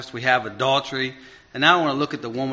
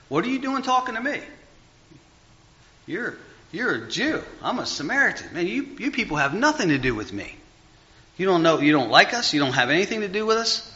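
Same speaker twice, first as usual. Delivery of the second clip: higher-pitched voice for an incredulous retort; Samaritan woman's challenge; 6 semitones higher than usual. Retold or imitated. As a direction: voice her pitched up, challenging him. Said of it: "She says".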